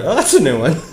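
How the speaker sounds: usual speaking voice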